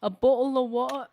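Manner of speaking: British accent, T dropped in "water"; put-on British accent. Shown as a demonstration. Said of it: "I added a different accent into that".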